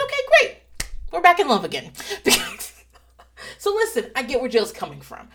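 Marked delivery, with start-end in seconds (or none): 0.0-1.9 bright high sing-song; mock cheerful. Wife to husband, high sing-song forgiveness. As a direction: chirp it like all is forgiven.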